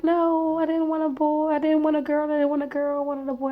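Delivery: high, whiny mock wail; crying. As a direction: act out tearful protest in a high voice. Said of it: "I cried".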